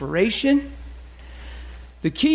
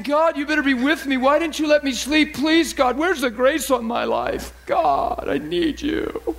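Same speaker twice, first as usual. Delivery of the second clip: high, pleading mock complaint to God; acted needy grumble; grumpy complaint; 7 semitones higher than usual. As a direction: plead crossly, stretching the last words. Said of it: "grumpy and you're saying".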